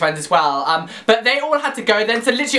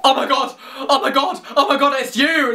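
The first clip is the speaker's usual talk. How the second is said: retold gushing, higher-pitched but only mildly raised; mock fan freak-out, repeated in bursts; starstruck screaming; 5 semitones higher than usual. Running up to a TV personality, mock screaming. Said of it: "Who is this bitch screaming at me".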